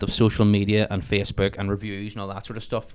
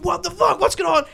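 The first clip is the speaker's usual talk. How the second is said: high, panicked yell retold; screaming at the neighbour to calm down. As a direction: yell it like you're being chased round a car. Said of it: "after screaming at him".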